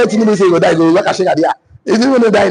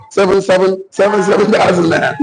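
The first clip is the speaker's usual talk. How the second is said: ordinary talk, nothing marked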